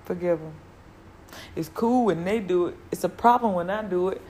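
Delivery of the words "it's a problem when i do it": normal talking voice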